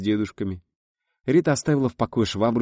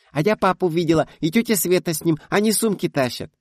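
put-on high, loud child voice; a girl blurting out news to mum; excited little girl; 11 semitones higher, 6 dB louder than usual. A narrator's reading. Daughter excitedly telling mum guests are coming.

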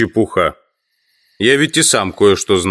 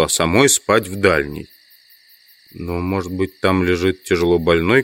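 ordinary delivery

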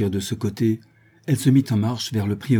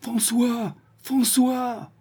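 anguished calls, much higher; tragic heroine sorrow; much slower than usual. A narrator's reading. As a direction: call for him in despair. Searching house, calling son.

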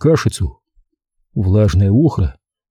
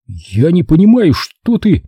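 higher-pitched, distressed protest; bewildered boy; 6 semitones higher, 3 dB louder than usual. A narrator's reading. Boy cannot understand his father's warning, high and bewildered. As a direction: blurt it out in confusion.

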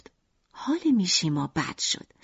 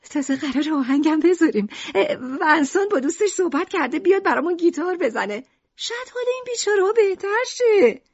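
much higher, cheerful mother; Lucrèce's voice; 9 semitones higher than usual. Acted. Mother cheerfully tells Mishima about the music plans, in a much higher voice. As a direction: chirp it brightly like the mother.

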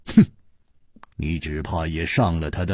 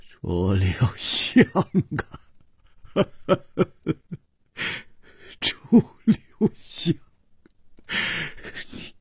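bitter laughter; 4 semitones higher, 3 dB quieter than usual. Acted. Li Yuhan laughs bitterly at Chu Liuxiang. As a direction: laugh out the name in bitter grief. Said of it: "他大笑着说".

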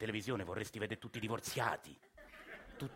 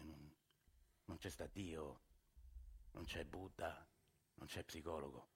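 much softer and lower, dying away slowly; fading despair; 6 semitones lower, 14 dB quieter, slower than usual. Acted. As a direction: let each line sink until nothing is left.

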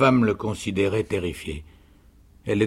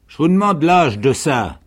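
higher, louder, insistent demand; 7 semitones higher, 7 dB louder than usual. A narrator's reading. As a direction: press the question as a soldierly canteen woman. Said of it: "La vivandière insista". (insistance forte, plus aiguë)

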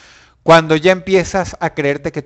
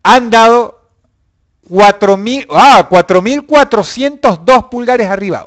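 excited; 6 semitones higher, 7 dB louder than usual. Real, not acted. Anuncio de pulgares a voces.

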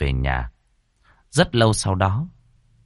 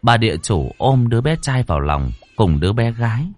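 ordinary delivery, nothing marked

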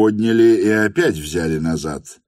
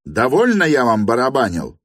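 higher, moderately raised protest; indignant cry; 6 semitones higher, 4 dB louder than usual. A narrator's reading. Lyamshin refuses the piano, higher indignant cry. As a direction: cry out that you've had enough. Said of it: "крикнул Лямшин".

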